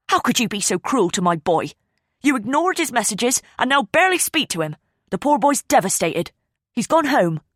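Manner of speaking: scolding. Acted. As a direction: defend her hurt son. Angry mother scolds Ava.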